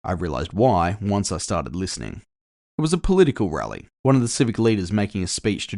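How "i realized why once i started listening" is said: usual speaking voice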